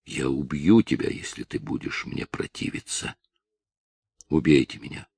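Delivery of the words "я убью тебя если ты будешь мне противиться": whispered threat, much softer; menacing whisper. Acted. Villain threatens to kill her. (угрожающий шёпот)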